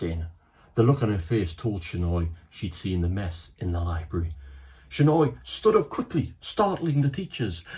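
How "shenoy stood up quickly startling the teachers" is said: ordinary delivery, nothing marked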